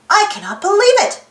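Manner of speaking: high excited exclamation; excited shout. Acted. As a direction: cry it out in disbelief. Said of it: "shouted Quincy".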